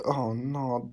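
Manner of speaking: usual speaking voice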